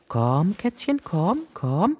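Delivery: high, soft coaxing call. A narrator's reading. Ella crawling toward the kitten, soft coaxing. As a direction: gently lure the kitten. Luring kitten under workbench.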